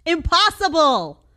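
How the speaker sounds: drawn-out impossible. Real, not acted. Mocking her old clip, loud slow impossible. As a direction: stretch it out in disbelief. Scoffing at her past self's claim.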